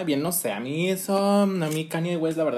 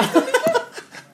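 squealing, very high laughter; a shrill laugh that breaks out; high-pitched laugh; 4 dB louder than usual. Real, not acted. Laughs at the mother joke. (risa muy aguda)

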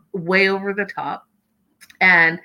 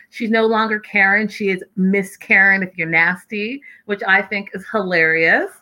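ordinary talk

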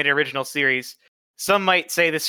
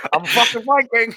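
higher, loud laughing brag; laughing Viking boast; 7 semitones higher, 5 dB louder than usual. Retold or imitated. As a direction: shout it like a proud Viking. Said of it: "I like boats".

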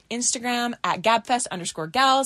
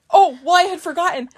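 normal talking voice

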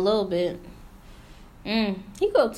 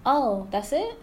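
mock doubting asker; 5 semitones higher than usual. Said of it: "somebody will say".

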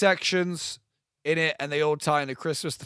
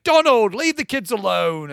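high, loud cartoon duck scolding; mock Daisy Duck; 7 semitones higher, 8 dB louder than usual. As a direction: shriek at him like a cartoon duck wife. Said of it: "It's like".

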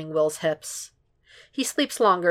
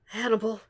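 higher, moaned name; moaning name; 5 semitones higher, 4 dB quieter than usual. A narrator's reading. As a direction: moan the name out. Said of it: "Will moans".

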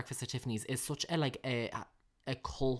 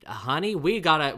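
loud, higher put-on American accent; 4 semitones higher, 10 dB louder than usual. Imitating the husband in Bewitched, loud American accent. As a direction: play an American sitcom husband. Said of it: "very American".

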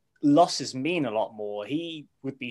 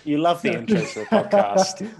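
laughing; 8 semitones higher, 4 dB louder than usual. Real, not acted. Teasing a co-host, laughing.

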